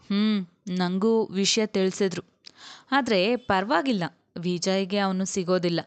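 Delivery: ordinary delivery, nothing marked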